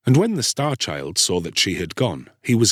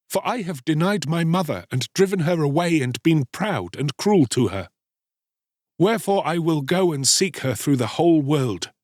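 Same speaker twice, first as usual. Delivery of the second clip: put-on higher-pitched boy in distress; remorseful Star-Child; 7 semitones higher than usual. A narrator's reading. Boy repenting cruelty to his mother, remorseful. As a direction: confess earnestly, then resolve.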